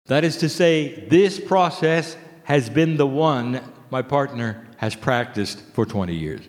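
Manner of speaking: usual speaking voice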